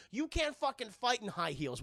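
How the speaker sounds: usual speaking voice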